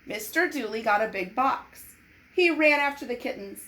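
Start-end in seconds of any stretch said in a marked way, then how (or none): none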